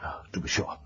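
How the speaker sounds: breathy near-whisper, half to himself; hushed aside. Acted. Landlord to scholar, covering his unease, hushed near-whisper. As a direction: mutter it nervously under his breath.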